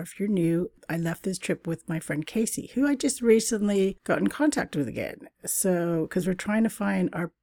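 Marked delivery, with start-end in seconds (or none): none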